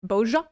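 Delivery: "Boja" said in two syllables, BOH-zhuh; variant pronunciation. Shown as a demonstration. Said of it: "saying Bozja differently".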